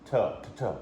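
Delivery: "tuh, tuh, tuh" as short separate clipped beats; single cut calls. Shown as a demonstration. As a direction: say "tuh, tuh, tuh" in even single cuts. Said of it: "I do lots of single cuts".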